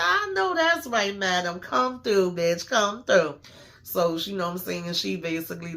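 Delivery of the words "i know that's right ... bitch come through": high, slow squeal of approval; hyped cheer. Real, not acted. Fan hyping madam after her burn, squealing high. Recapper hyping the character's comeback.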